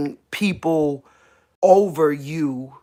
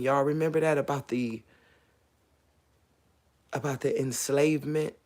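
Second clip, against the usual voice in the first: much softer, overcome and slowed down; emotional; 4 semitones lower, 6 dB quieter than usual. Real, not acted. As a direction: let the feeling pull the voice down to a near hush. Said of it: "I'm so emotional".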